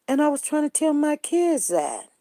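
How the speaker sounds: ordinary talk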